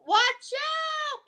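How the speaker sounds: high, loud shout of warning; warning cry. Acted. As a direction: call out to warn the little one. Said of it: "Papa cries".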